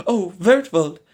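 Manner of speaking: high, loud swooning call of his name; enraptured cry. A narrator's reading. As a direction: call him in rapture before fainting. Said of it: "cried the enraptured maid".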